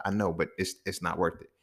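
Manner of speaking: low, hushed retold reasoning; soft dissuasion. Homegirl telling him it's not worth it, quietly dissuading. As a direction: talk a friend down very softly.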